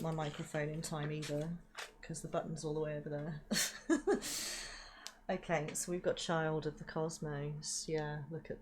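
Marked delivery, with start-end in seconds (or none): 3.5-5.2 airy giggle, pitched way up; a quick embarrassed giggle; high breathy laugh. Real, not acted. Laughing at the mute button.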